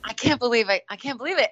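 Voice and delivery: high-pitched astonished self-talk; giddy, high inner voice; amazed disbelief. Retold or imitated. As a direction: relive the disbelief, voice lifted high. Reliving amazement at working show.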